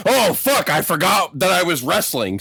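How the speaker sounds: hammed-up sudden realisation; Hulk remembering. Hulk remembers the match, mock startled. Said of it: "he's like".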